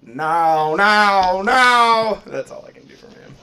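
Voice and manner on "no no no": rising loud higher cries of no; Cleveland shouting no. Retold or imitated. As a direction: yell it louder each time like Cleveland.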